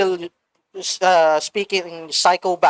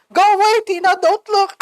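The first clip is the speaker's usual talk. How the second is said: high, louder cry of a dying father; mock father's scream; 12 semitones higher, 5 dB louder than usual. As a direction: cry out high, urgently warning a child away. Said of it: "her father was screaming".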